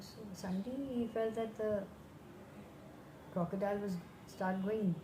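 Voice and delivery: ordinary delivery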